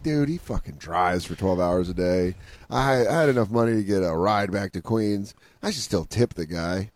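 low, subdued self-reproach; mock guilty conscience. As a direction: sink into a low, soft guilty mumble.

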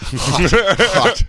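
puffing out hot, hot through a full mouth; words blown out round a hot bite; mouth full of hot food. Real, not acted. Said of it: "Burn, burn, hot".

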